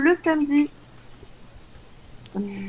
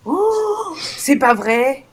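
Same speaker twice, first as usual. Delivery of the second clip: surprised; 3 semitones higher, 5 dB louder, much slower than usual. Real, not acted. Mock surprise at the joke.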